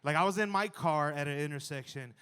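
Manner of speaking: ordinary talk, nothing marked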